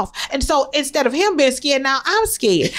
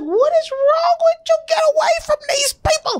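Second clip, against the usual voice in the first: high, strained cry of a panicking mother; panicked mom. Retold or imitated. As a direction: shriek it like a mom who just lost control of her kid. Mom panics at son approaching strangers.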